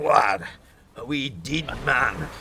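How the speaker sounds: slow, lightly done Scottish accent; drawn-out Scottish lilt on D'd. Shown as a demonstration. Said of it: "a fine Scottish pronunciation".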